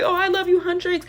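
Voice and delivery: high, adoring fan squeal; gushing fan. Retold or imitated. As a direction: sell it like a starstruck fan. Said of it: "He's like".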